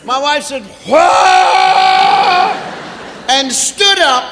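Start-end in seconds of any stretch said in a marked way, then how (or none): 0.9-2.6 high, loud, drawn-out howl; comic scream of labor pain; pained labor wail. Retold or imitated. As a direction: howl as a huge contraction hits. Wife cries out in labor pain.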